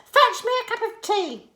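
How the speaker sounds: high, loud bossy granny; high loud old woman, demanding; bossy Grandma. A narrator's reading. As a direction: order the tea as Grandma. Grandma orders from the roof.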